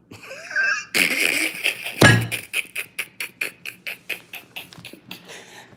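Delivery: high, unvoiced screaming laugh; laughing hard at his own mafia bit; shrieking laugh. Real, not acted. Bursts out laughing.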